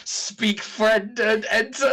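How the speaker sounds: high, loud grand recital; Elvish inscription voice. Acted. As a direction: proclaim it like an ancient elf. Quoting the Elvish door inscription.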